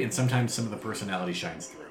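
ordinary delivery

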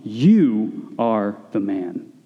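very slow, low-pitched pronouncement; deliberate, low accusing line; solemn retold accusation. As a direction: draw out each word as a weighty verdict. Nathan's famous accusation to David.

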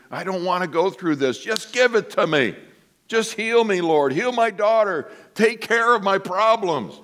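high, whining plea; whiny pleading. Retold or imitated. Believers complaining to God in testing, whiny high plea. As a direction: beg like a complaining child. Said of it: "and we".

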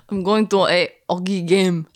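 put-on French Canadian, dropping the H in hockey; French Canadian accent. Wife having a go at husband's line, French Canadian accent. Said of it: "Does that sound more French Canadian?".

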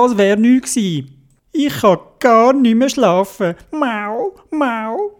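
a sleepy cat grumbling; high whiny kitty complaint; grumpy cat voice. Acted.

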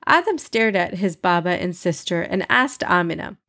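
ordinary delivery

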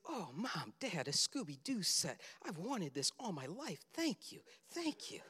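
put-on soft, hushed child-like gushing; delighted little boy. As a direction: imitate an overjoyed child, pitch leaping on the thanks. Acts out delighted boy opening gift.

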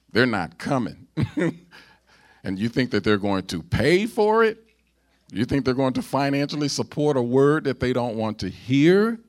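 loud, high, incredulous preacher; fired-up pulpit challenge; preaching at full force. Real, not acted. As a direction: raise the voice hard into disbelieving questions.

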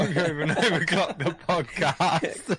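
slow laughing reread; laughing repeat. Retold or imitated.

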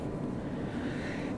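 long breathy sigh; heavy sigh. Acted. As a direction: let out a weary sigh. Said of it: "Elmander gave a heavy sigh".

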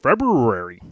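February with the r's overdone and drawn out; muddled pronunciation. Real, not acted. Host unsure how the month is said, muddled pronunciation. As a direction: stumble through the r's in "February". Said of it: "I always say that one wrong".

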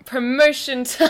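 drawn-out, giggly sing-out; laughing echo. Pretend. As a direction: laugh while echoing the announcement. Laughing echo of segment title.

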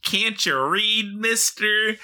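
high, slow taunting sing-song; mocking Blizzard. Blizzard taunting Aaron over the book, high slow sing-song. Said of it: "shows the book and says".

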